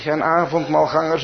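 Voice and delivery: ordinary talk, nothing marked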